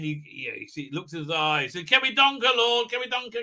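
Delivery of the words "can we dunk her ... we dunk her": lightly acted high-pitched plea; mock pleading. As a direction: beg like an eager underling, half-mocking. Eager plea to the lord, acted.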